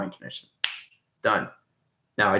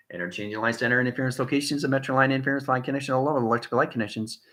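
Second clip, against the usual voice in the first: ordinary talk, nothing marked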